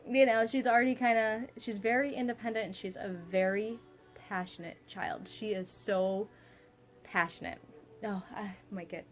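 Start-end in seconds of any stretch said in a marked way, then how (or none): none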